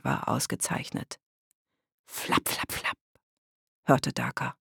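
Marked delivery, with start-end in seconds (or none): 2.1-3.0 soft, voiceless flapping sound; leise nachgeahmtes Flattern. A narrator's reading. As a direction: whisper a quick flap of wings. Geräusch, das Daka hört. (leises, gehauchtes Flattergeräusch)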